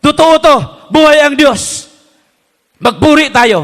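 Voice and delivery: convince her it is real; earnest insistence. Retold or imitated. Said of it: "Sabi niya".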